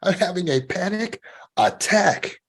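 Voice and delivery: slow scary version. Shown as a demonstration. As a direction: let the words sink in with dread. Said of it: "that sounds scary too".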